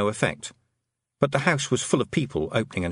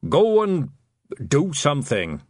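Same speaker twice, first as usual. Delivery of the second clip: higher, louder panicky father; father sending his daughter off, impatient and louder; 5 semitones higher, 5 dB louder, slower than usual. A narrator's reading. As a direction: snap it out, worried and short. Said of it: "looked panicky and impatient".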